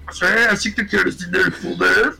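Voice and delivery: half macabre, half Mexico City demon; menacing demon offering a deal. Acted. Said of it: "voy a intentar a imitar esa voz de demonio".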